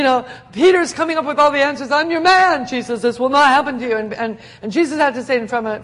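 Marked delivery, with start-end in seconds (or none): 1.9-4.0 eager Peter. Retold or imitated. Peter's bragging voiced.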